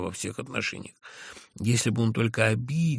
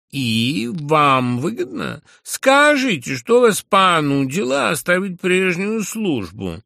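high, slow, loud patronizing drawl; lisping drawl; 9 semitones higher, 9 dB louder, slower than usual. A narrator's reading. Zverkov patronizingly quizzes the narrator on his job. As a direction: drag out the condescending question.

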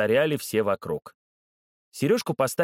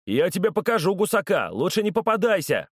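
threat yelled out the window, higher; growled threat; 7 semitones higher than usual. A narrator's reading. Bully roars from the window at the boy, raised threat. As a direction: roar back a warning out the window. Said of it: "рявкнул".

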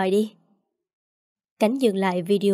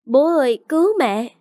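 put-on high, louder panicked child's cry for help; 5 semitones higher, 4 dB louder than usual. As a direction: scream for dad like a terrified little boy. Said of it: "Tôm khóc thét". (trẻ con hoảng sợ kêu cứu, cao và to)

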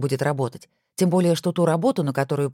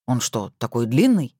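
slow, puzzled question; surprised; 4 semitones lower than usual. Acted. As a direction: ask in slow disbelief. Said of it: "удивился Максим". (удивлённо, медленно)

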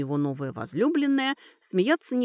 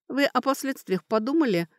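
plain reading voice

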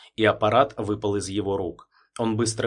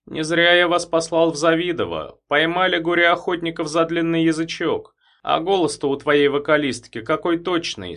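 pleased chief; 7 semitones higher, 5 dB louder than usual. Acted. Andropov pleased with result.

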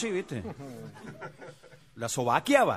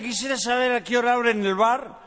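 put-on drunk voice; 7 semitones higher, 4 dB louder than usual. Drunk asks when bar opens.

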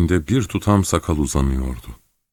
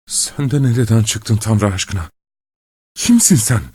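shocked outburst; 4 semitones higher than usual. A narrator's reading. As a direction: demand it in disbelief. Shocked father confronts old baby.

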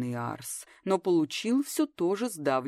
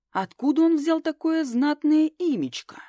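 astonished; 10 semitones higher, 6 dB louder than usual. A narrator's reading. Astonished at grand name.

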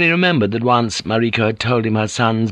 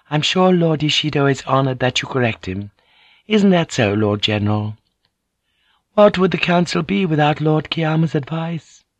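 high, coaxing noble lady; lady Ochiba; 7 semitones higher than usual. A narrator's reading. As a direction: persuade the general sweetly. Ochiba teases the council.

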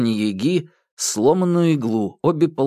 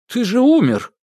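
shocked cry; 12 semitones higher, 5 dB louder than usual. A narrator's reading. Ivan shocked Koshchei lives.

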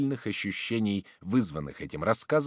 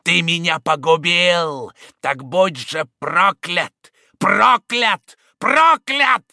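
slow, loud, rising vengeful cursing; vengeful curse; 9 semitones higher, 12 dB louder, much slower than usual. Acted. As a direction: hurl the curse ever louder. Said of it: "мстительным злобным голосом она кричала".

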